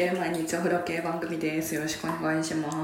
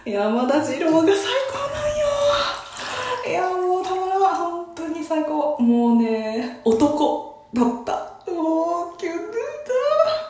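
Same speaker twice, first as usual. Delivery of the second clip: gushing fangirl; 9 semitones higher, 5 dB louder than usual. Real, not acted. Gushing over her favourite idol.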